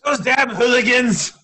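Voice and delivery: put-on high, loud cranky old man; grumbling old codger shout; old prospector. Joining the old-man bit.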